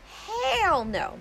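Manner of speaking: high, drawn-out outraged exclamation; indignant disbelief. Retold or imitated. Caller realizes she was blocked, indignant disbelief. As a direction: stretch it high in sheer disbelief. Outraged reaction to hearing it ring.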